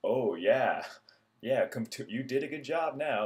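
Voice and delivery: sarcastic patient; sarcastic praise. Retold or imitated. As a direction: tease with fake approval. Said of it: "'Cause it's like".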